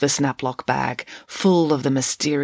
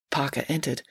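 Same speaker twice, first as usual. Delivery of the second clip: ordinary delivery, nothing marked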